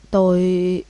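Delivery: drawn-out, halting words; unfinished stammer. A narrator's reading. Drugged drunk girl tries to answer, halting stammer. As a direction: struggle to start a sentence. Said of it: "Một câu nói còn nói không hoàn chỉnh".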